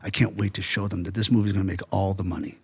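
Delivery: low, hushed relish; James Cameron. Retold or imitated. Host quotes the director on his doubted movie, low and quiet. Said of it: "That's what he said, literally".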